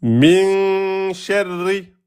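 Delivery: slow chanted "Min sharri" with a long held min; Quran recitation. Shown as a demonstration. Teacher chanting verse slowly for students. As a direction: intone it like tajwid recitation. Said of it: "vous avez quoi".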